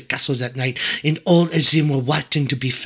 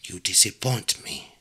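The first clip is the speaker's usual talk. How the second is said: low, soft witch's verdict; quiet menace; 6 semitones lower, 9 dB quieter than usual. A narrator's reading. Witch about to curse princess.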